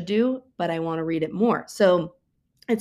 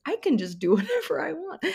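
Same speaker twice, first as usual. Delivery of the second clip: laughing; 9 semitones higher than usual. Real, not acted. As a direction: giggle breaking into the line, a little higher. Laughing at own adult freedom.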